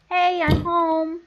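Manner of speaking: slow sing-out to the household; calling out. Acted. Coming home to the family, calling out. Character arriving home calls out.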